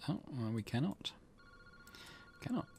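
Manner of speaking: ordinary talk, nothing marked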